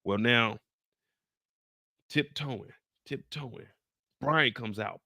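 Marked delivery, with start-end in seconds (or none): 2.1-3.7 much softer, creeping delivery; hushed. Retold or imitated. Jen sneaking away, much softer hush.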